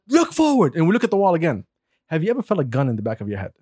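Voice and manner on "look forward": high-pitched imitated police command; retold sharp command; mock cop order. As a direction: call out an order like a jumpy cop. Mimics cop barking an order.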